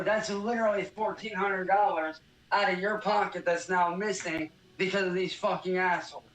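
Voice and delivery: usual speaking voice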